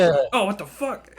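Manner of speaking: loud, high, drawn-out outburst; startled guard yell. Acted. Game master voices a guard stuck at the closed desk, startled yell. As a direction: yell it in shocked disbelief.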